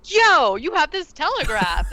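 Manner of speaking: loud, high-pitched mock excitement; excited. Jenny interrupts his bad news, excited. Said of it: "she's, like, all excited".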